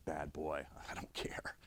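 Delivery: whispered, self-mocking aside. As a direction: hush it like a naughty confession. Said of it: "It's like".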